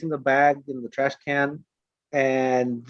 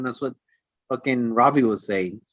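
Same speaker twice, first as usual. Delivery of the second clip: usual speaking voice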